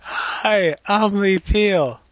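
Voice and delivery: slow, high, badly faked Southern accent; a hammy Southern drawl intro. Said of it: "I can't do a Southern accent".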